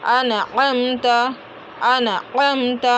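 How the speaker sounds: अनअमत with light first a, then throaty squeezed "am"; slow अनअमत, the second अ from mid-throat, m held; hamza versus ain example. Shown as a demonstration. Teacher giving an example of the difference, careful recitation. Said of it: "आइन में फ़र्क होना ही चाहिए".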